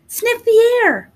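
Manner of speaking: high, slow, drawn-out urging; eager little boy. Acted. Hansel urges Gretel to sniff the air, high and slow. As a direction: coax her to breathe it in.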